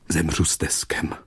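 breathless whisper, fading away; a dying creature's whispered farewell. Acted. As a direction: whisper it as your last words.

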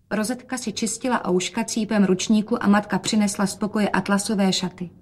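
ordinary delivery

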